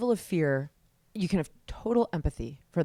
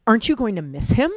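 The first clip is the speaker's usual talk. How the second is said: louder, pointed accusing question; accusatory; 5 semitones higher, 10 dB louder than usual. Retold or imitated. Other parents questioning her about her son, pointed and louder. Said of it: "somewhat accusatorily".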